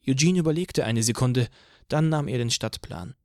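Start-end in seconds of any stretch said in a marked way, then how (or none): none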